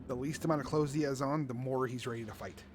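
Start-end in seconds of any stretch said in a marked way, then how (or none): none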